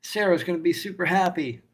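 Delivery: cheerful barkeep. Acted. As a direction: play the beaming innkeeper. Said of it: "the barkeep goes".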